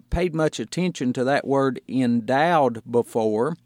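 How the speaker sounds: usual speaking voice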